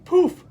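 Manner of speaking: high, loud burst of a poof; bright magical poof sound. A narrator's reading. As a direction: pop it out like a magic trick. Bus transforms, sound effect word.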